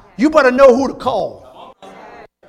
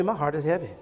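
much softer and lower, heavy-hearted echo; softly repeated; 6 semitones lower, 11 dB quieter, faster than usual. Shown as a demonstration. Pastor to church, hushed heavy-hearted repeat. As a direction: let the repeat fall away quietly. Repeat dropped low and soft.

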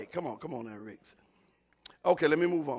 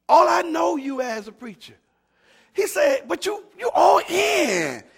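higher, louder, slowing on "all in"; fervent quote; 13 semitones higher, 11 dB louder, slower than usual. Retold or imitated. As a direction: lift the son's words with preacher's fire. Quoting son with fervor.

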